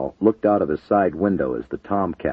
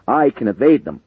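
loud urgent cry; shouting; 7 semitones higher, 7 dB louder than usual. A narrator's reading. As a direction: push it out over the noise. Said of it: "Matthews shouted".

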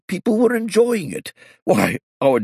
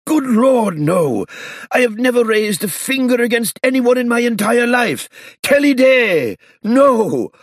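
high, loud flustered denial; old man crying out in shock; alarmed protest; 5 dB louder than usual. A narrator's reading.

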